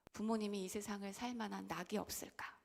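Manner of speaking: ordinary delivery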